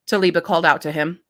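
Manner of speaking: ordinary delivery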